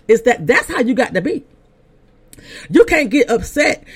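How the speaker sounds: preaching. Real, not acted. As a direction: exhort the congregation, pitched high. Preaches to the listeners.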